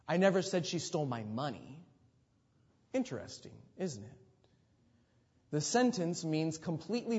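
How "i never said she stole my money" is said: "money" stressed at the end; emphasize "money" above all other words. Shown as a demonstration. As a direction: save the weight for "money". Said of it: "emphasize a different word".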